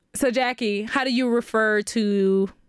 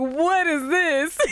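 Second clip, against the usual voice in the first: mock baffled, drawn out and higher; retold bafflement; 5 semitones higher, much slower than usual. Retelling a boy reading her note, slow mock bafflement. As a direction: stretch it slowly in imitated disbelief. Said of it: "And he was like".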